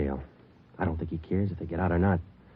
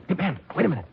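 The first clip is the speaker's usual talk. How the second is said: raised, high call to stop a partner leaving; calling after him; 9 semitones higher, faster than usual. Acted. Detective calls his partner back, raised and urgent.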